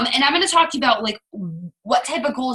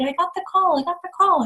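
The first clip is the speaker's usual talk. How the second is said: high, giddy anticipation; breathless eager chant; excited; 7 semitones higher, faster than usual. Retold or imitated. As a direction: bounce it up, can't wait. Excited retelling of awaited call.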